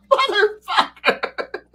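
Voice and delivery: drawn-out high squeal of a curse; long shocked falsetto curse; stunned curse. Retold or imitated. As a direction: stretch it out, way up high and slow. Narrator voices own stunned reaction.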